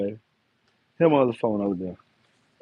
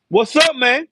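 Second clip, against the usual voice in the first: high, loud greeting; hype greeting; 9 semitones higher, 7 dB louder, slower than usual. Real, not acted. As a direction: shout a hello to the camera.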